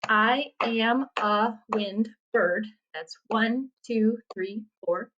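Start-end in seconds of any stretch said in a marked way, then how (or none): 0.1-2.6 "I am a wind bird" slowly, each syllable separate. Shown as a demonstration. Teacher counts syllables in "I am a wind bird", syllable by syllable. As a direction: break "I am a wind bird" into five spaced beats. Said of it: "if you count the syllables".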